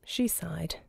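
sighing, whispered narration. A narrator's reading. Narrator describing Kanjar's sigh, whispered. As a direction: breathe the line out wearily.

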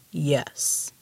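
low, deep giant voice; mountain giant. A narrator's reading. The giant confirming he understood Ramona, deep voice. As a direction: answer like a rumbling mountain.

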